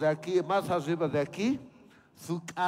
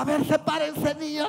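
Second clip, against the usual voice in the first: high, louder stern teacher; 9 semitones higher, 4 dB louder than usual. Retold or imitated. Teacher separating the fighting kids, high and stern. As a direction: break up the kids like a strict teacher. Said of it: "Venía la profesora".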